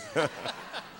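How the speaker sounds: short high laugh. Real, not acted. Rapper laughing at the host's praise. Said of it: "that's the only way I can stay on beat".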